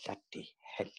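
whispered, weary curse; Richard Wilson. Acted. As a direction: mutter it under the breath in dread. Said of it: "Richard went".

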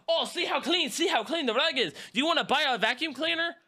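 high, sugary salesman pitch; pushy vacuum salesman. Retold or imitated. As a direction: ham up a nineties door-to-door seller. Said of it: "They're like".

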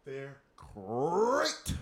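rolled, rising mascot growl on great; cereal mascot catchphrase. Acted. Joking about Frosted Flakes, hammed-up mascot catchphrase. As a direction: roar out the word great like a cartoon ad.

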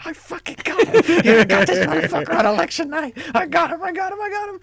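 gloating. Retold or imitated. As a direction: crow like you pulled off the prank. Lewis gloating over dosed Ari.

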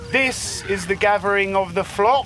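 trying out a big proclamation; rehearsed announcer voice. Acted. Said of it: "I have been practicing a little something".

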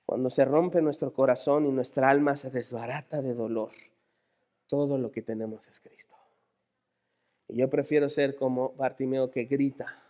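low, soft, grave reflection; hushed solemnity. Real, not acted. Preacher on a heart broken by pain, hushed solemnity. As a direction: let it sink almost to a whisper.

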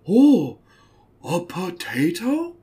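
high, loud, slow dopey voice; mock clueless boyfriend. Mocking the boyfriend to the parents, slow dopey high voice. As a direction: play dumb in a drawn-out high voice.

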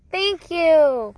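high singsong thanks. Real, not acted. Thanking toddler handing things over.

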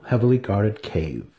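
plain reading voice

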